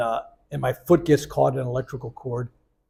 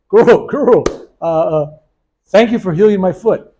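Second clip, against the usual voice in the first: raised, loud call, then hesitant thanks; eager calling out; 4 semitones higher, 7 dB louder than usual. Retold or imitated. Patient running after the guru to thank him, eager call.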